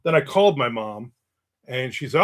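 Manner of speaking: normal talking voice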